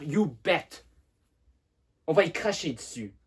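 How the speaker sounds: enraged. Acted. Simone vows to spit on his mother's grave, shouting with rage. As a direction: hurl it out as a vengeful, shouted threat. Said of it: "Simone a beaucoup de rage pour sa mère".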